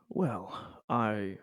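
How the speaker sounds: ordinary talk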